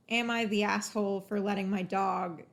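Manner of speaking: usual speaking voice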